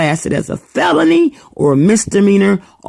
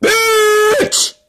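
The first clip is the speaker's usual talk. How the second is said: "Bitch!" much higher, held long with a rising end; Freddy voice. Shown as a demonstration. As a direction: stretch "Bitch!" up high and drawn out like Freddy. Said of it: "I gotta do my Freddy voice".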